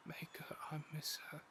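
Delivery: breathy whisper, barely there; whispered longing. Acted. Adventurer whispering about his absent bride, longing. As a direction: whisper it to yourself, aching for her.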